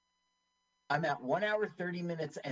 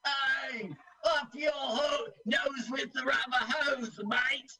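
put-on botched Australian accent; 11 semitones higher, slower than usual. Mocking Australian TV characters for the co-host, botched Australian accent. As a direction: holler it in a bad Australian accent. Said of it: "I'm keep doing Cockney. I can't do Australian".

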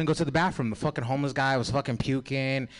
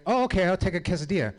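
eager; 7 semitones higher, 5 dB louder, faster than usual. Retold or imitated. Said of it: "I was like".

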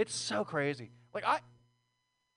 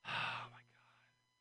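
whisper; 12 dB quieter, much slower than usual. Real, not acted. Stunned after the set.

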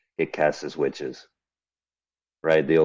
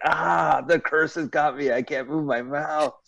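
high, slowing melodramatic wail; hammy stage actor; 9 semitones higher, slower than usual. Retold or imitated. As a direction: overact a cursed victim. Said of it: "do things like this".

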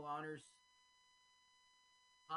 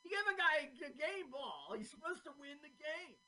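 loud, high, indignant protest; exasperated Brian Piccolo; 9 semitones higher, 7 dB louder than usual. Acted. Brian Piccolo berates the team for losing his game, exasperated. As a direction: yell it like a let-down player. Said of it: "So Brian Piccolo's like".

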